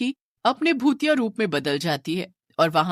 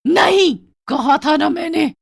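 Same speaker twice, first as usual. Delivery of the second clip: much higher, louder snarl; angry ghost; 8 semitones higher, 8 dB louder than usual. Acted. Ghost woman rejecting the family's plea, angry shout. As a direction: refuse them furiously.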